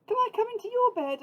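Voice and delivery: high, loud, quick little mouse plea; Little Mouse pleading. A narrator's reading. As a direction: beg like a tiny mouse. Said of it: "asked Little Mouse".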